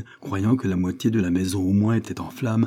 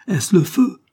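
high, louder cry of alarm; frightened shout; 11 semitones higher, 7 dB louder, slower than usual. A narrator's reading. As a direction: yell it out in fear. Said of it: "cria Wilson".

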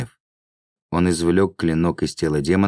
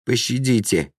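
higher-pitched tearful begging; tearful plea; 6 semitones higher than usual. A narrator's reading. Laam begs his attacker for mercy, tearfully. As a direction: beg for mercy, close to tears. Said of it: "слёзно воскликнул Лаам".

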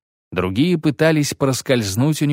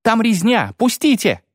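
high, desperate soldier's plea; panicked cry; 12 semitones higher, 3 dB louder than usual. A narrator's reading. Soldier pleads to escape the slaughter, high panicked cry. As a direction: beg to be let through. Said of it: "крикнул кнехт".

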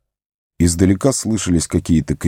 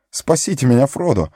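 higher, frantic call for help; panicked cry; 7 semitones higher than usual. Acted. Sam in the water crying to Frodo, higher and panicked. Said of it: "заполошно выкрикнул Сэм".

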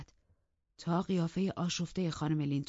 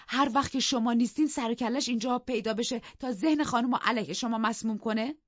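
high, louder pointed questions; defiant challenge; 8 semitones higher, 5 dB louder than usual. Acted. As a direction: needle the master. Nelly challenges master.

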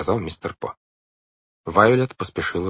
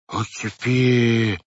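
put-on high, drawn-out baby babble; toddler exclamation; 5 semitones higher, much slower than usual. A narrator's reading. Baby sister chiming in to agree, toddler exclamation. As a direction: stretch it out like a small child piping up. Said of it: "воскликнуло солнышко".

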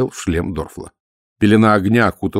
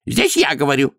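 higher, cutting interjection; curt rebuke; 7 semitones higher than usual. A narrator's reading. High priest silences fellow priests, curtly. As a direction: cut the others off sharply. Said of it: "отрезал он".

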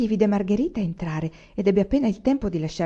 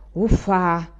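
huffed "uffa"; mild snort of annoyance; exasperated huff; 4 dB louder than usual. A narrator's reading. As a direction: puff it out, fed up. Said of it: "egli sbuffò".

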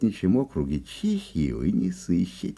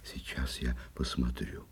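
hushed; 8 semitones lower, 9 dB quieter than usual. Acted. Checking trap quietly.

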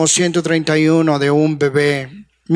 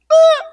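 put-on bored child "ah"; 6 dB louder than usual. Imita niño indiferente.

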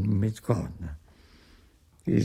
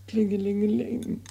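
imitated bell ringing; 10 semitones higher than usual.

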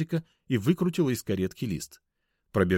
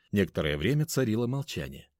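usual speaking voice

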